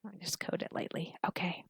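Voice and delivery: drowsy whisper; fading off to sleep; sleepy. Real, not acted. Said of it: "I feel like I'm falling asleep".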